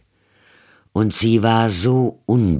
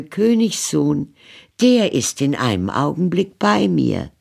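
put-on high, light girlish voice, eager; a hopeful young girl making plans; Rapunzel, young maiden; 12 semitones higher than usual. A narrator's reading. Rapunzel speaks to witch.

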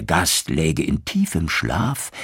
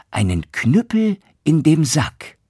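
higher, proud announcement; proud son; 9 semitones higher, 5 dB louder than usual. Acted. Son proudly telling his father about the cudgel. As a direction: present the prize with pride.

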